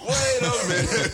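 reacting to the finger-gun gesture; startled whoa. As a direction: pull back in alarmed surprise. Teacher makes gun gesture, retold.

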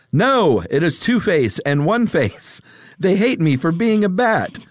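lightly voiced superhero; Batman voice. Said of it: "Batman speaks".

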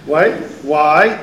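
usual speaking voice

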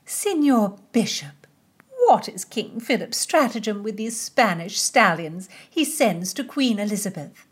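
a pointed high court question; high lady voice. A narrator's reading.

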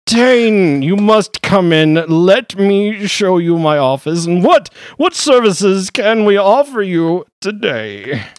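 high, loud, fawning lawyer; a high-voiced wizard lawyer ushering a client in; pompous gentleman. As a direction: welcome the client in grandly. GM plays the lawyer.

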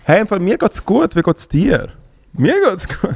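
high voice. Shown as a demonstration. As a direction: pitch the voice up. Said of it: "das ist meine Stimme".

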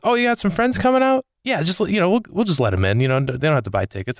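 high, casual mock manager voice; lightly voiced club manager offering free entry; imitating the manager. Club manager tells comedian friends get in free, high mock voice. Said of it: "and he goes".

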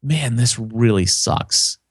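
slow, down-in-the-dumps sigh of a line; mock glum. Podcaster showing listeners how his voice sounds sad, slow and glum. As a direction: let it sag like a bad day. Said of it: "They actually hear when you say".

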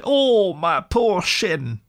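high, slow wail of a hurt mole; slow, high yelp of pain; cry of pain. A narrator's reading. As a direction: whimper it out as if the leg really hurts. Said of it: "he cried".

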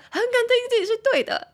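high, loud self-correction; high-pitched correction. Real, not acted. Teacher corrects her own slip, high and loud.

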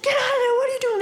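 high-pitched yell of an impatient driver; mock shouting. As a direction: imitate a driver hollering out of the window. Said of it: "he's just shouting at me".

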